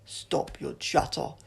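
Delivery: slow, loud harsh alien; shouted alien order. A narrator's reading. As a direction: bark it as a soldier alien. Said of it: "shouted the Sontaran".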